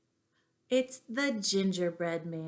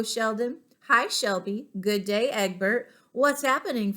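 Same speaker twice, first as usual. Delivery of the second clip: usual speaking voice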